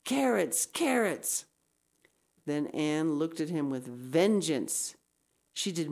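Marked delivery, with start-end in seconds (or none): 0.1-1.5 high mocking taunt, voiced not whispered; sing-song schoolboy teasing; a boy taunting a girl across the aisle; piercing whisper. A narrator's reading. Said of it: "said in a piercing whisper".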